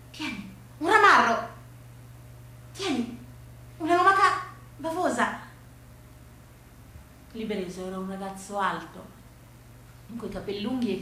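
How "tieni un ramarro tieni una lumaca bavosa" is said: teasing. Acted. Boy teasing girl with gifts.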